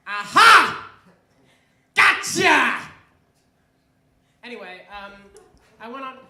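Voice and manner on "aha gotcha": much louder, pitched-up gotcha; sudden triumphant shout. Pretend. Comic springs a surprise on the audience, triumphant shout. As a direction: burst out with a huge triumphant yell.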